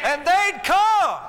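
high, loud, drawn-out preacher's holler; exultant shout. Real, not acted. Preacher recalling packed buses to his church, exultant shout. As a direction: cry it out slowly to the whole congregation.

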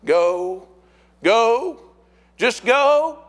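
rising, building cheer of encouragement. Acted. Folks in heaven cheering us on, building cheer. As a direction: start near normal, climb to a high, loud urging. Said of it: "up there cheering us on and saying".

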